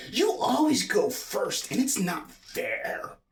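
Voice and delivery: high, drawn-out whiny complaint; loud demanding tone. Shown as a demonstration. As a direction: protest it slowly at a high pitch. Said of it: "use a loud, demanding tone of voice".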